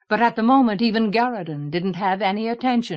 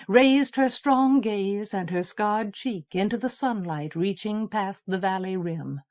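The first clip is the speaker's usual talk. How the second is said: ordinary delivery, nothing marked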